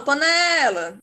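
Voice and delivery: "panela" with a long, called-out NE; stress on NE. Shown as a demonstration. Teacher showing the strong syllable, NE drawn out. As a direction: stretch the middle of "panela": pa-NEEE-la. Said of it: "o que demora mais é o né".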